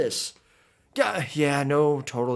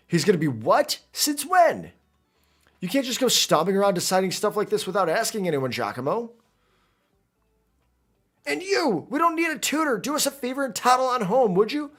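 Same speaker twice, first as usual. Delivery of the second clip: put-on much higher, sneering young rival; indignant rival; 7 semitones higher than usual. Ortega telling the new tutor to toddle home, high and scornful. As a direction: shoo the tutor away with high-pitched scorn.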